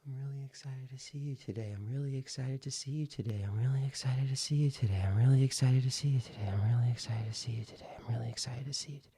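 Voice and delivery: fast, breath-driven repetition without pauses; rapid run-on drill, thinning out at the end; speaking on the exhale. Shown as a demonstration. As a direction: keep repeating on one breath until the air runs out. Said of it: "let me demonstrate for you".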